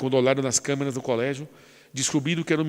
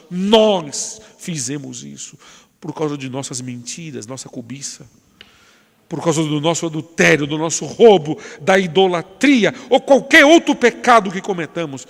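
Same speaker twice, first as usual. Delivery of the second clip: higher, louder preaching at full force; fiery preaching; 4 semitones higher, 5 dB louder than usual. Acted. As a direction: preach it with fire, climbing to the peak.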